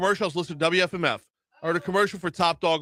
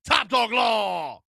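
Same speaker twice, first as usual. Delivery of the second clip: ad tagline; slower than usual. Retold or imitated. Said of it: "the commercial goes like this".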